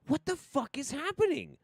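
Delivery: very high-pitched bewildered whine; incredulous, strained high voice; exasperated disbelief. Real, not acted. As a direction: squeak it out in total bafflement. Losing patience with broken audio.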